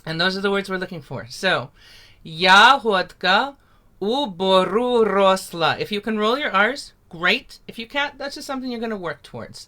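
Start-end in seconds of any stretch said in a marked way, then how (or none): 2.2-5.8 slow, carefully spoken "Yahodka u boru rosla" with rolled r; teacher modelling a Ukrainian line; slow Ukrainian demo. Shown as a demonstration. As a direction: spell out "Yahodka u boru rosla" slowly for learners. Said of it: "So everyone say with me".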